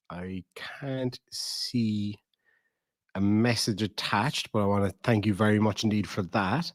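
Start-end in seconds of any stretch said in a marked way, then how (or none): 0.1-2.2 breathy whisper, slow. Real, not acted. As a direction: murmur it under the breath. Reading donations, drops to whisper.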